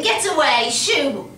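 loud shooing shout. Acted. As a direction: yell to chase the bird away. Shooing a bird off the fence.

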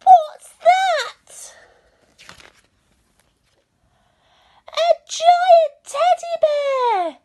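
very high, loud gasp of shock; high, loud, drawn-out astonishment; startled exclamation. A narrator's reading. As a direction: cry out in amazement. Eddie startled by giant bear.

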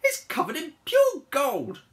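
high, loud, slow boast; imperious queen. Acted. Cleopatra showing off her staff, grand and loud. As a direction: draw it out haughtily.

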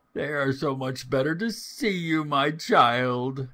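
a hint of sly wolf playing granny; wolf posing as grandmother. A narrator's reading. Wolf answering the little girl from bed, lightly disguised voice.

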